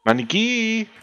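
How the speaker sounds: sing-song high reassurance, played up; mock consoling. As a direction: coax it in a high pretend-comforting voice. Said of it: "Nos acercamos a consolar al maniquí".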